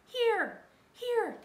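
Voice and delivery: high, loud repeated call; urgent calling out. A narrator's reading. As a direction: yell to guide someone over. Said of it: "cried Annie".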